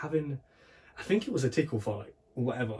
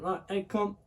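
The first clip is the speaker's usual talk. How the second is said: mock mum, pitched higher, firm; brisk higher-pitched mum voice; mum calling; 10 semitones higher than usual. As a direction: call the kid over like mum. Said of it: "mum just went".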